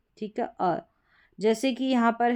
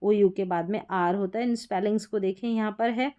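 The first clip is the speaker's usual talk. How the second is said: ordinary delivery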